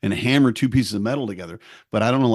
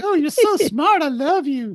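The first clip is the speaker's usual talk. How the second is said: very high, mock adoring voice; hammed-up lovestruck wife; swooning wife. Wife impressed by finance talk, swooning. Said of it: "you'd be like".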